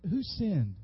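normal talking voice

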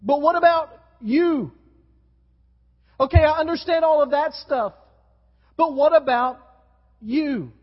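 pressing question. Retold or imitated. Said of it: "He looks at him and he says".

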